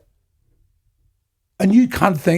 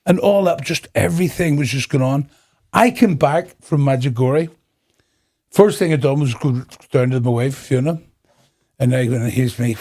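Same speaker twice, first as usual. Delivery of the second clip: ordinary delivery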